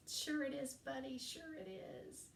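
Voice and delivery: mock humouring. Teacher answering student about his name, high-pitched humouring. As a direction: humour him in a high voice, trailing off. Retold sceptical reply to student.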